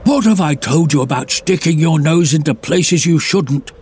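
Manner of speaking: stern fatherly bellow. Acted. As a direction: call her name sharply, then scold. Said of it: "bellowed Dad".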